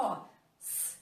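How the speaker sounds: "S" as a long voiceless hiss; "S" as pure unvoiced friction; hissed S sound. Shown as a demonstration. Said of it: "o S faz esse som".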